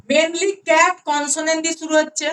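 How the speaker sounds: normal talking voice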